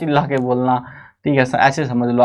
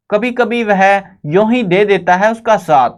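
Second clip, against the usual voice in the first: "कभी-कभी वह यूं ही दे देता है उसका साथ" recited higher, stress on ही; poem recitation; 5 semitones higher than usual. Shown as a demonstration. Teacher reads the poem line before explaining it, reciting. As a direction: declaim the verse line. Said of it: "कई बार क्या होता है".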